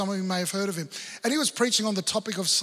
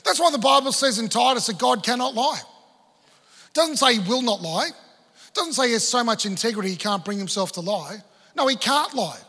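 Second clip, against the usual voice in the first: raised, fervent preaching voice; full-force sermon delivery; 4 semitones higher, 6 dB louder than usual. Acted. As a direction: drive the point home to the congregation.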